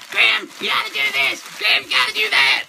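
very high, squawking nag; mock nagging wife. Wife bursting in with chores, high mock nagging. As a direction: nag in a silly high caricature. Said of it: "Probably open the door any minute now going".

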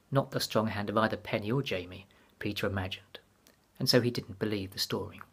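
ordinary delivery, nothing marked

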